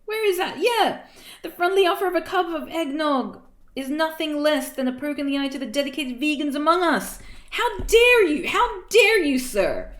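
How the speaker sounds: loud, high outraged proclamation; indignant tirade. Acted. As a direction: thunder with mock-offended outrage. Reader's indignant tirade at story.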